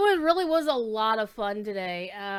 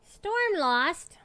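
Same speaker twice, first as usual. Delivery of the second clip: raised, higher-pitched name call; calling the pet; 6 semitones higher, 4 dB louder than usual. Real, not acted.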